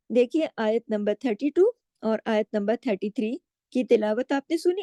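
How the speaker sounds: usual speaking voice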